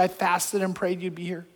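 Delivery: hushed, softly retold tearful words; understated tearful murmur; imitated crying. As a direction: quote her tears in a low-volume voice. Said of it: "is crying and crying".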